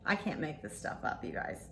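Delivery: soft laughing whisper to the camera; whispered aside. Real, not acted. Telling viewers about the messages, laughing whisper. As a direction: breathe it out as a secret aside.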